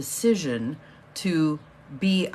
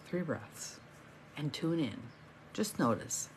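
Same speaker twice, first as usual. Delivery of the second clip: three breaths, said much softer and gently; soft guiding voice; 4 semitones lower, 10 dB quieter than usual. Shown as a demonstration. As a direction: let "three breaths" drop to a soft, calm hush.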